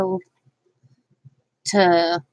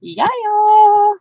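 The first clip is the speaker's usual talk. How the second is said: much higher, louder hammed greeting; exaggerated channel greeting; James Lee impression; 12 semitones higher, 6 dB louder than usual. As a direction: go all-out on the YouTuber hello. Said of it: "You can tell I watch Emily's channel".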